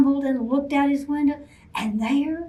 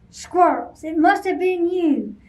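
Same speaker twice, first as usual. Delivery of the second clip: louder, higher bear accusation; angry accusation; 5 semitones higher, 5 dB louder than usual. Acted. Bear accusing the squirrels, loud angry. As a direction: accuse them loudly.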